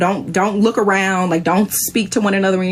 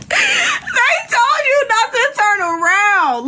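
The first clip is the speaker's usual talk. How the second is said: much higher, slow, drawn-out mock scolding; exaggerated scold; 14 semitones higher, much slower than usual. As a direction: stretch each word like a teasing reprimand. Repeats scolding louder, slowly.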